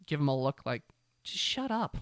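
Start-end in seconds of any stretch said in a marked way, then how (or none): 1.2-2.0 annoyed. Retold or imitated. Girls' annoyed look, voiced.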